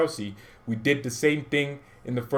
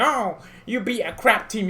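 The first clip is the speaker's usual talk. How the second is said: mocking Chelsea fan; 10 semitones higher than usual. Mocking Chelsea fans' taunt.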